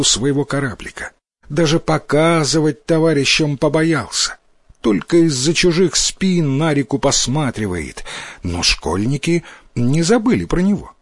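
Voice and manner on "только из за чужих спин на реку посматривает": plain reading voice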